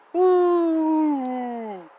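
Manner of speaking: a high gleeful woo; excited whoop. Acted. Boy on the twisty slide, gleeful whoop. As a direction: whoop like you're zooming down a slide.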